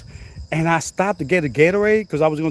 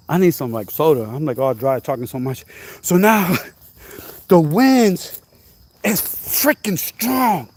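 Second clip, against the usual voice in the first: ordinary talk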